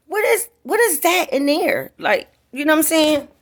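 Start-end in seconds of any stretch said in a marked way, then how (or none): 0.1-1.9 high, excited question; higher astonished asking; retold amazement. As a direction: lean in amazed, voice jumping up.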